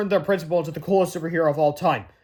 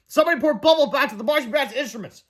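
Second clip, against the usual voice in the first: indignant fan; 7 semitones higher than usual. Acted. Fan accusing.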